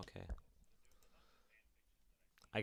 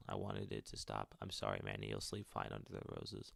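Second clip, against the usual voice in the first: a much lower, hushed mother's confession; low confessing mother; 11 semitones lower, 8 dB quieter than usual. Acted. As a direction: read the note low and quiet, like a guilty secret. Mother's confession note read aloud.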